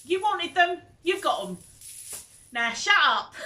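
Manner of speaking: loud, high bossy playful friend; mock-bossy friend telling her to shut up, loud; friend's bossy comeback. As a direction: snap it fondly like a cheeky aunt, ending in a laughing shout. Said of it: "She's got".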